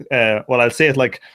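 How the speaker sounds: normal talking voice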